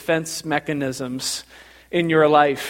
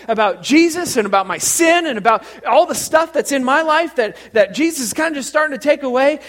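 chatty parishioner, climbing higher as she goes; Teresa impression; 6 semitones higher, 4 dB louder than usual. Churchgoer recounting her shower experience to pastor, building impression. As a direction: tell it eagerly with rising pitch. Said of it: "That's exactly how she talks".

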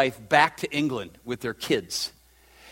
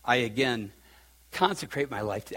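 softer, choked-up prayer; real emotion, much softer; emotional; 5 semitones lower, 4 dB quieter than usual. Real, not acted. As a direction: pray it as feeling wells up. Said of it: "I'm getting emotional".

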